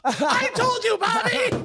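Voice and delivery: very high squealing character voice; Anthony. Pretend. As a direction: shriek a gloating I-told-you-so in falsetto. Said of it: "Anthony rolls up".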